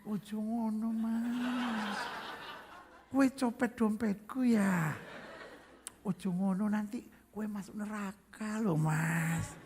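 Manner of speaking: soft, slow, stretched-out mock scolding; silky-gentle Javanese. Mimicking a Javanese warning the pickpocket of hell, silky-gentle. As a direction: chide very politely, lingering on word ends. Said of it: "lebih lembut daripada kain sutra".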